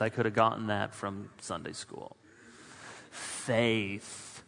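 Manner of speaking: ordinary delivery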